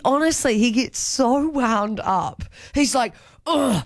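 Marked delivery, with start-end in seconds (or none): 3.5-3.8 exasperated complaining. Retold or imitated. Partner imitated complaining about movie sounds.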